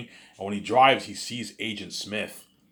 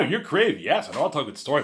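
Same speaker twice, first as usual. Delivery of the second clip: mocking imitation; 7 semitones higher, 6 dB louder, faster than usual. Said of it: "He's like".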